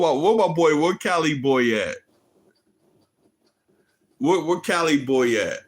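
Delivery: loud call across the room. Real, not acted. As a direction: shout for your friend to show up. Calling out for a friend.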